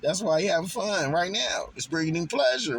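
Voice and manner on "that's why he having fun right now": normal talking voice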